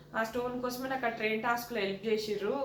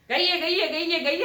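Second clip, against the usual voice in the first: mock yelling noise; 5 semitones higher, 6 dB louder than usual. Mocking her shouting habit.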